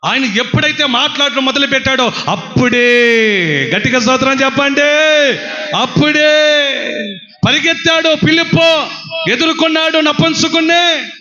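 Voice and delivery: high, loud fiery preaching; preaching at full force. Acted. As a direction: proclaim it to the congregation.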